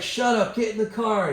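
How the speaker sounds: high-pitched, exasperated dismissal; imitating annoyed friend. Friend in the car dismissing injured man's story, exasperated. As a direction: wave him off, voice jumping up.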